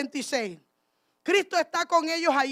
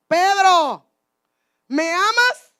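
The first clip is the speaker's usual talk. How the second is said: loud, higher call, rising on the question; 4 semitones higher, 7 dB louder, much slower than usual. Acted. Christ to Peter after the resurrection, calling questioning. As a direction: call out the name, pause, then ask loudly.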